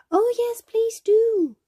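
put-on very high, loud child's squeal of a plea; eager little boy. A narrator's reading. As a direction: beg excitedly like a small boy, pitched right up. Little boy eagerly begs for tour.

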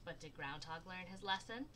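plain reading voice

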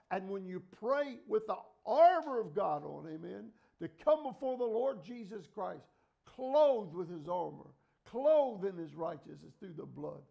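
loud preaching, slowing on clothed; rousing sermon cadence; preaching at full force. Acted. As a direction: proclaim it to the church. Preaching surge.

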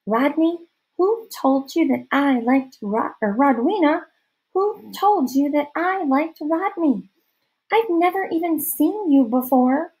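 put-on high, questioning girl's voice; puzzled girlish interrogation; suspicious girl. A narrator's reading.

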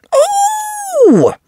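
very high surprised oh; a sudden yelp; startled cry. Acted. Richard crying out as the ball bounces away, startled. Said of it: "cries Richard".

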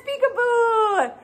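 high, sing-song peekaboo; playful peekaboo. Acted. Host uncovering the baby for toddlers, high sing-song peekaboo. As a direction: make it a happy reveal.

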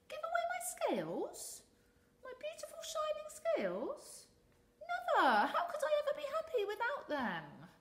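very high, loud mocking fish; high scornful laugh. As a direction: cackle at the idea, vain.

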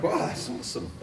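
whispered wow through a laugh; airy chuckling wow. Retold or imitated. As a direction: breathe it out, amused and delighted. Reacting to a compliment on shirt.

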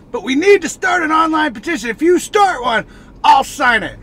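rally the viewers loudly; excited rallying call. Real, not acted. Rallying viewers to start petition.

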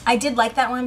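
usual speaking voice

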